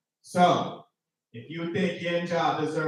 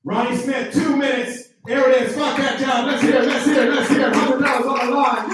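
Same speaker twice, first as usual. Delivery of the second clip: hype announcer; 6 semitones higher, 7 dB louder than usual. Real, not acted. Host hyping comic on stage.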